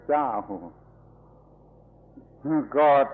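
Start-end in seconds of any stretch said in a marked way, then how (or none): 2.8-3.1 emphatic God. Real, not acted. Preacher proclaims God emphatically.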